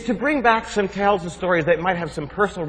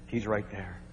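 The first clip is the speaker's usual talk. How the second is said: hushed refrain; 8 semitones lower, 13 dB quieter than usual. A narrator's reading. Dramatic repeated refrain.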